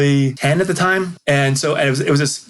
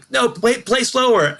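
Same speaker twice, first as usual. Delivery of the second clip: retold yelling; 9 semitones higher, slower than usual. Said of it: "yelling at them".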